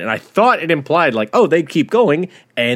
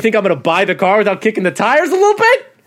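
higher, quicker mock indignant retort; 8 semitones higher, 4 dB louder than usual. Girlfriend defending herself to boyfriend, quick indignant retort. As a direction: fire it back fast like a defensive boyfriend.

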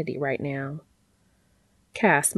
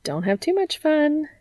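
high, lilting woman's character voice; amused, singsong parting jab; teasing smirk; 10 semitones higher than usual. A narrator's reading. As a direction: tease knowingly in a much higher voice. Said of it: "she added with a smirk".

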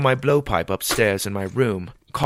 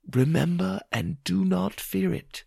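Mother Moon; 9 semitones higher than usual. A narrator's reading. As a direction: hush it like an oracle.

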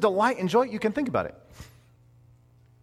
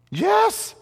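very high, loud yes; emphatic yes; 5 dB louder than usual. Real, not acted. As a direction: fire back a delighted yes. Emphatic answer to own question.

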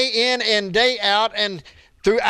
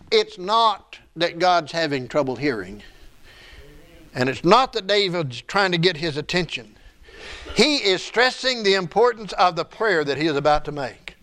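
ordinary delivery

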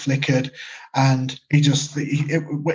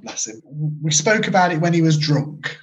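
ordinary talk, nothing marked

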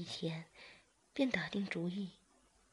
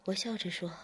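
usual speaking voice

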